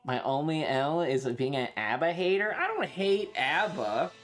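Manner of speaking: ordinary delivery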